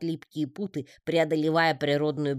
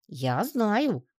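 гордо; 6 semitones higher than usual. A narrator's reading. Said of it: "гордо ответил Гишка".

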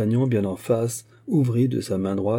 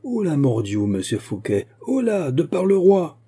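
high hailing call, swelling louder; commanding shout; 7 semitones higher, 4 dB louder than usual. A narrator's reading. Captain hailing the man he pursues, commanding shout. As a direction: hail the fugitive, pitch leaping at the end. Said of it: "gronda le capitaine".

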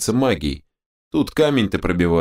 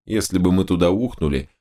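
ordinary delivery

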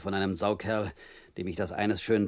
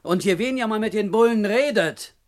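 threatening; 12 semitones higher, 8 dB louder than usual. Acted. Threatening Pierre about police.

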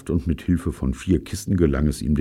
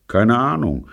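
higher-pitched, unconcerned reply; unmoved; 7 semitones higher, 4 dB louder than usual. A narrator's reading. Culprit answering his friend's question, unmoved. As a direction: answer without any remorse. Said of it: "gab Robert ungerührt zurück".

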